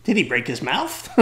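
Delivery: put-on child asking in astonishment; little American girl. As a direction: voice a puzzled little girl's question. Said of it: "little American girl".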